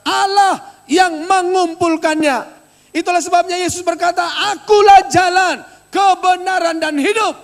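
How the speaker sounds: loud, high pulpit proclamation; preacher booming the promise at the church; fiery preaching. Acted. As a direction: thunder the promise to the congregation. Preaching at full force.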